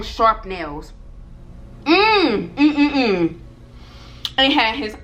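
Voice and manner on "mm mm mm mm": high first hum, then shorter ones; disapproving "mm, mm, mm". Real, not acted. Recoiling from remembered long nails.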